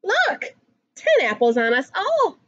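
ordinary delivery

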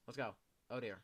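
ordinary delivery

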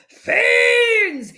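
high, very loud furious shout; fuming. A narrator's reading. Furious farmer to the raiding foxes, fuming. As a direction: shout it in rage, drawing it out. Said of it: "he fumed with a fierce frown".

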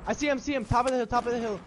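panicking gamer. Real, not acted. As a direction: rattle it off in a hurry. Spotting enemy mid firefight.

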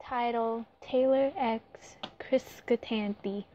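ordinary delivery

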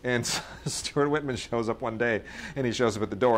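ordinary talk, nothing marked

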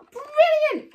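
high, loud burst of praise; delighted teacher exclamation; excited praise. Real, not acted. Praising young children.

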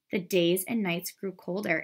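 ordinary delivery

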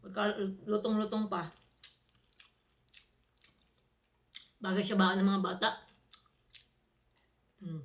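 eating between words; talking while chewing. Real, not acted. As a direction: mouth partly full of food. Seen 1: Bites chicken at -5, chews and eats by hand throughout.

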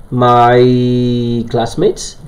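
very slow, drawn-out English question; rising, careful dictation; slow model sentence. Shown as a demonstration. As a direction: spell it out word by word for the class. Teacher dictates slowly.

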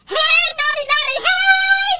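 very high squeaky little creature calling; shrill tiny tin-can critter; tiny robot voice. A narrator's reading. Tin can robot tugging Jesse's leg, high squeaky call.

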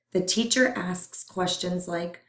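plain reading voice

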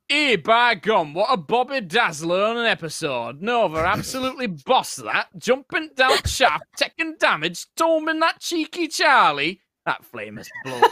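put-on Yorkshire accent. Reading a donation in a requested Yorkshire accent. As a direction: ham up a broad Yorkshire read, rising. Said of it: "Yorkshire accent, please".

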